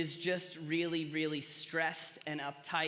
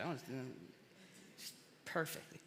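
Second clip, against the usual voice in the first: dreamy hush; 5 semitones lower, 10 dB quieter than usual. Real, not acted. Dreamy peanut butter image.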